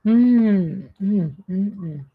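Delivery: appreciative hums while eating; savoring mm. Real, not acted. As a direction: hum with pleasure over food. Tasting the food.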